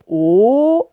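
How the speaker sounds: "O" as a long closed /o/, loud. Shown as a demonstration. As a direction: hold a rounded, closed "O". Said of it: "fermé". (« O » fermé, long et fort)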